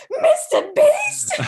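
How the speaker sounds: very high, slow, laughing shriek; freaking out. Retold or imitated. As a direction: squeal it in disbelief. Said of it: "freaking out".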